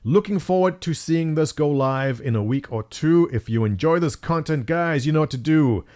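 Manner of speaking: ordinary talk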